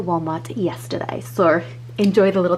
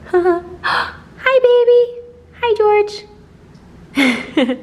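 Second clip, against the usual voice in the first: high, cooing pet voice; 13 semitones higher, 5 dB louder, much slower than usual. Real, not acted. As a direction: coo at the puppy. Greeting the dog.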